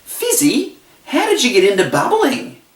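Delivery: high, sing-out questioning voice; curious audience question. Retold or imitated. Host voicing what people oftentimes ask him, high and curious. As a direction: imitate eager askers, pitched well up. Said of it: "oftentimes people ask".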